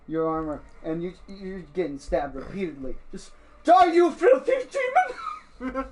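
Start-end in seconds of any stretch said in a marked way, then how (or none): 3.6-5.8 high, loud attacking shout; frantic battle cry. Acted. Panicked villager attacks an armored intruder with a pitchfork, frantic shout. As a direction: yell it as you stab at an intruder.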